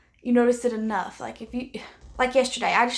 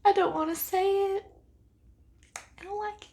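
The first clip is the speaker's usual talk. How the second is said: higher, pained admission of dislike; pained reluctance; 9 semitones higher than usual. Real, not acted. Vlogger confessing she dislikes her friend's palette, pained and high. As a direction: force the words out like it hurts. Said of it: "it crushes me to say that".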